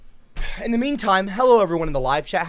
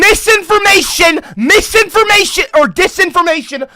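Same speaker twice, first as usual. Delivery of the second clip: high, loud interruption; indignant shouting; 9 semitones higher, 4 dB louder than usual. Real, not acted. Streamer cutting in on Joe's claim, indignant shout. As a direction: call out the falsehood over the speaker.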